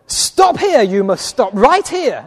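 loud, high start easing down slowly; urgent, agitated order to halt; agitated yelling. Retold or imitated. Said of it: "she was very agitated".

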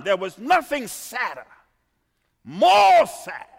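fervent sermon delivery; impassioned preaching. Acted. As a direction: wail the disappointment from the pulpit. Preacher lamenting fruitless tree.

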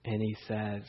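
normal talking voice

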